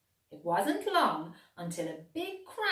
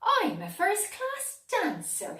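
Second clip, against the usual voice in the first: much higher, louder proud boast; Clegane's boast; 12 semitones higher, 5 dB louder than usual. A narrator's reading. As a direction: show off like a little performer. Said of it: "said Clegane".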